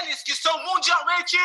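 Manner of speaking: ringmaster. Acted. Show intro, announcer voice.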